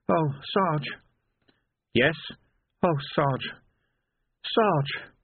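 put-on a shaken boy who can barely speak; tearful young constable. A narrator's reading. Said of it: "Tears were running down the Lance Constable's face".

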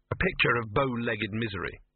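ordinary delivery, nothing marked

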